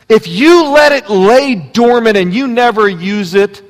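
loud, high, driving sermon voice; full-force preaching. Acted. Pastor urging the congregation to find their gift, preaching loudly. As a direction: preach it out with rising fire.

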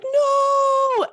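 very high, loud, drawn-out no; reenacted scream on waking; retold scream. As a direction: shriek one long NO. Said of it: "screamed at the top of my lungs".

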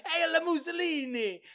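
high, loud, slow mock Italian cry through a laugh; mock Italian salute. Quoting the sitcom Italian's salute, mock Italian accent. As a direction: bellow it like a comic Italian officer. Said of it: "That's what he actually".

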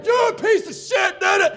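very high, loud mock screech; furious kid imitation. Angry kid stands up at the comedian, high screeched insult.

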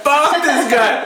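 high, very drawn-out dismissal; slow mock-scornful chorus; quoted others joining in. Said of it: "And they were like".

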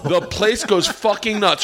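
ordinary talk

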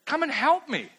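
high, whiny, exasperated plea; Martha complaining. Acted. Martha complains to Jesus about her sister, high whiny plea. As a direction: play Martha nagging Jesus, high and put-upon. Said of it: "close paraphrase".

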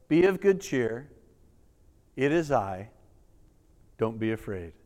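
calm frightened men gently; tender hushed promise; reassuring softly. Acted.

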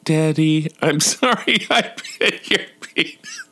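put-on high kid voice breaking into laughter; laughing child imitation. Dad replaying son's apology, high voice dissolving in laughs. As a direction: mimic the little boy while cracking up. Repeats son's line, cracking up.

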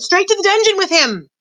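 high-pitched condemning shout; sustained high cry. A narrator's reading. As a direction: demand it from the crowd. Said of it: "a voice cried out".

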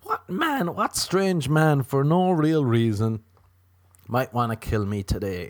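high, theatrical questioning; mock anxious wondering. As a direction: wonder aloud like a grim game-show riddle. Acts women's guessing game.

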